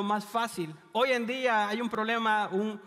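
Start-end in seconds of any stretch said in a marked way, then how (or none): none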